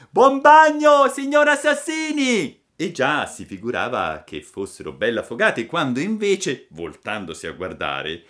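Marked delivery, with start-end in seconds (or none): 0.1-2.6 high, very loud shout; gleeful yell. A narrator's reading. Puppet taunts soaked pursuers while running, gleeful yell. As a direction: yell it out in mocking glee on the run. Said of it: "urlò ridendo e seguitando a correre".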